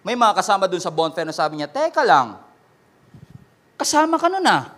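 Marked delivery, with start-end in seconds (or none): 1.7-4.7 accusing call. Acted. Bonfire companion accusing Peter.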